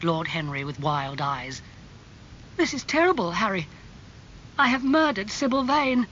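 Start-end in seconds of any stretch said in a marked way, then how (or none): 2.6-6.1 high-pitched, distraught outburst; horrified. Acted. Dorian confesses guilt to Lord Henry, horrified. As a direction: blame yourself in horror.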